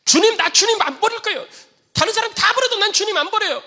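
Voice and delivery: high, loud brash vow; boastful Peter. Acted. Peter boasting loyalty to Jesus, high and loud. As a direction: shout the bold promise like a cocky disciple.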